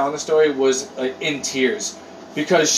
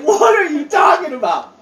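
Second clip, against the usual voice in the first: exasperated mom; 8 semitones higher than usual. Retold or imitated. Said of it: "And his mom's like".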